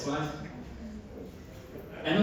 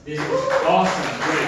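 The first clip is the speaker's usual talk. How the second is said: excited; 8 dB louder than usual. Real, not acted. Cheering the organizers at event end.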